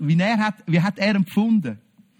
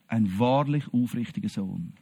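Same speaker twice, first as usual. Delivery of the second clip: much lower and softer, gentle; hushed, loving words of Jesus; tender compliment; 10 semitones lower, 6 dB quieter than usual. Acted. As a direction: let it fall softly like a blessing.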